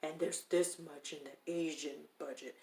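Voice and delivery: a hint of a condescending producer; imitating the condescending producer. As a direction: spell it out like a patronizing boss talking down. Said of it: "this is how he's talking to me".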